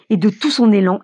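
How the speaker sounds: usual speaking voice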